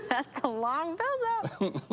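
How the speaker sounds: slow, drawn-out words through laughter; amused complaint stretched out on a laugh. Real, not acted. Listener laughing at the long joke.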